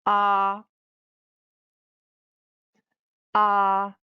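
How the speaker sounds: a long, loud, steady "ah" on one pitch; first tone. Shown as a demonstration. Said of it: "The first tone".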